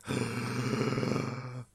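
long mock gasp of revelation; dramatic gasp. Co-host re-enacting the big reveal, long dramatic gasp. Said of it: "he does the big reveal".

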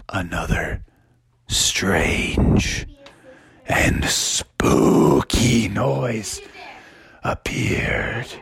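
low, breathed, very slow suspense; spooky whisper. A narrator's reading. Narrator builds suspense on the street, eerie whisper. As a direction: draw it out in an eerie half-whisper.

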